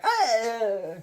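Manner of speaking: high, laughing mock scream; hammed-up dying cry through laughter; mock death cry. Acting out woman cut in neck.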